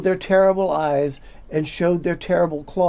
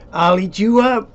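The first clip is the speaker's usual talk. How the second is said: loud, higher, a mock monster threat from a little boy; Max's playful threat; 5 semitones higher, 9 dB louder than usual. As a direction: growl it out like a cheeky wild child. Max threatens mother, playful.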